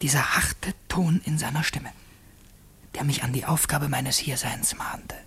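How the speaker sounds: soft unvoiced whisper, thinking aloud unheard; a secret aside to himself while the governor waits, whispered; whispered inner voice. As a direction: breathe the thought so the governor cannot hear. Inner voice in the scene.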